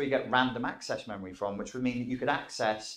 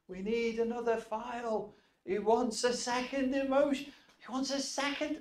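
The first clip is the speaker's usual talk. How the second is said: very high, flustered clerk voice; fussy inner clerk; 12 semitones higher than usual. Acted. Clerk relaying the request for emotion, very high and flustered. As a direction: squeak it out like a harried office clerk.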